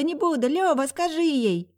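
put-on high pleading little boy; pleading child. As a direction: beg big brother for help. Child protests, jumping on bed.

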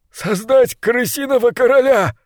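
high, bursting blurt. Acted. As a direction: let it burst out of him at once. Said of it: "выпалил крысолов номер два".

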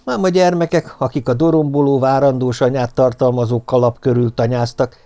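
plain reading voice

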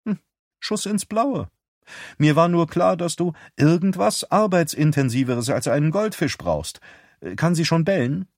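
lightly voiced sister on the phone; Beth's voice.

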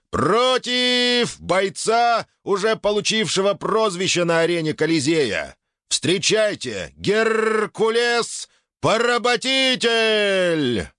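very high, slow ring announcer shout; booming prize announcer. Acted. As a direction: stretch every word to the crowd. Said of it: "ведущий объявляет мой выход на арену".

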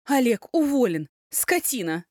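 high, loud furious yell; furious shout. Acted. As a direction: yell him fired, livid. Elya shouts in fury.

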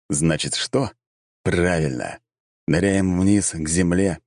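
ordinary delivery

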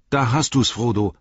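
Mary, eager and fired up; excited Mary. A narrator's reading. Mary voiced by reader. (aufgeregter Mary)

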